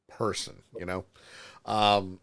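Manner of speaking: normal talking voice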